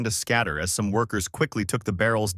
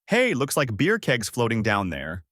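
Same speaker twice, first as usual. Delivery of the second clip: ordinary delivery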